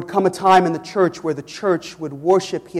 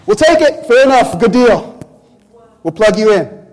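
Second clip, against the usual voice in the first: high, loud, eager people snapping up the deal; preacher mimicking the people eagerly accepting the bramble; eager crowd; 6 semitones higher, 10 dB louder than usual. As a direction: sell the crowd's quick cheap yes. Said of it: "You know what the people say?".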